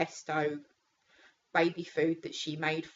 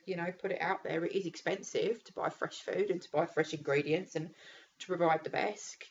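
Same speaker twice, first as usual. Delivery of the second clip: usual speaking voice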